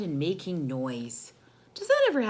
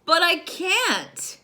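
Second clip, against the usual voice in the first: high, loud, slow whiny complaint; little sister protesting to big sister, whiny; frustrated little sister; 11 semitones higher, 7 dB louder, slower than usual. Acted. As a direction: plead that you truly can't. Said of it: "said Layla".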